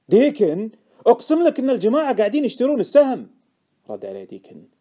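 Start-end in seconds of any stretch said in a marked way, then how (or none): 0.1-3.3 high, loud, pleading oath; urgent insistence. A narrator's reading. Informant swearing to Deacon they are buying, urgent.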